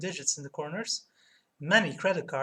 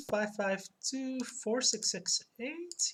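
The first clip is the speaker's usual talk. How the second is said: slow, questioning rise on each group; feeling your way back to the number; unsure recall; 6 semitones higher, 3 dB quieter, slower than usual. Real, not acted. Streamer checking the number with chat, unsure questioning tone.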